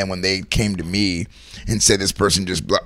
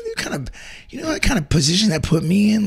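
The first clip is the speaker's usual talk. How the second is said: usual speaking voice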